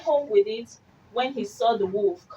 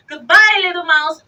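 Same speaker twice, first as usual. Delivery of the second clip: hasty goodbye; 8 semitones higher, 8 dB louder than usual. Acted. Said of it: "away he sped".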